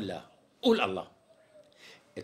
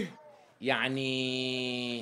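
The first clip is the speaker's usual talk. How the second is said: one long, drawn-out word; stalling; 2 semitones lower, much slower than usual. Acted. Tailor puts off naming the price, stalling. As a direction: stretch the word to buy time.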